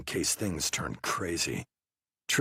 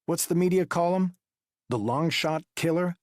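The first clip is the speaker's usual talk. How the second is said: high, pressing investigator voice; interrogating detective; 10 semitones higher, 4 dB louder than usual. Acted. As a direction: push him with pointed questions. Wu needling Trilling.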